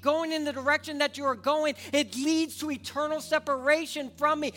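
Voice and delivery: loud, high impassioned sermon; preaching at full force. Acted. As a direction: preach it to the back row. Preaching God's plea.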